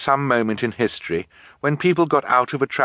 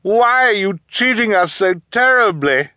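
high, loud, anguished appeal; crying out; 8 dB louder than usual. A narrator's reading. Orphan begging cruel guardian for a reason, crying out. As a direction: plead loudly, pitch held up. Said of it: "Violet cried".

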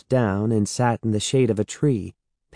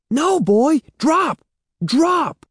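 high, loud alarmed commands; alarmed call; 4 dB louder, slower than usual. A narrator's reading. Evan begging the dog to drop the ball, alarmed. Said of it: "Evan called, alarmed".

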